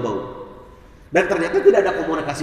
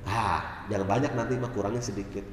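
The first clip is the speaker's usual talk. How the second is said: much lower and softer, easy reply; soft reassurance; 10 semitones lower, 9 dB quieter than usual. Retold or imitated. Wife softly telling her husband the salt is fine. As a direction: gently play it down.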